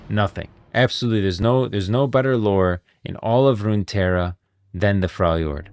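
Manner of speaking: normal talking voice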